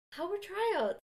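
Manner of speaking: high, bright mom question voice; a mom gently asking how it went. Retold or imitated. Son back from tryouts, mom asks in a high, bright voice.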